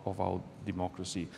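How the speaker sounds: ordinary delivery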